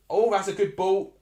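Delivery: loud, high burst at a good ball; excited. Real, not acted. Streamer watching the match reacts to a great ball, loud and excited. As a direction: cheer the pass out loud.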